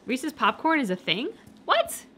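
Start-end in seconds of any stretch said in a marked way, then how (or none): 1.7-2.0 very high incredulous what; high shocked what; surprised. Real, not acted. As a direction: squeak of disbelief. Reacting to Reese's popcorn.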